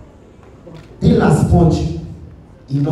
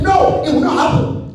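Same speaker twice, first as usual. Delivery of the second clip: much higher mock stern father; hammed-up strict dad, flat refusal; father refusing; 11 semitones higher than usual.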